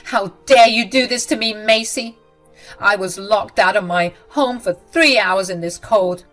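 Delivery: loud, indignant scolding; angry. Acted. Mother-in-law loudly scolding Macy over the cold. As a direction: threaten her, outraged.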